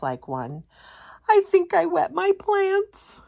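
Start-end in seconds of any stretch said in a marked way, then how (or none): 1.3-3.2 much higher, squeaky frightened quip; a scared plant joking at night; high jittery sprout voice; nervous joke. Acted.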